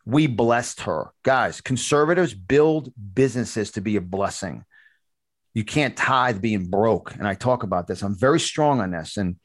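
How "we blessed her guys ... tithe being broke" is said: slow emphatic. Real, not acted. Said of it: "Let me tell you something".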